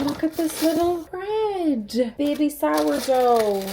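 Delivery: high, slow cooing over a cute little loaf. Real, not acted.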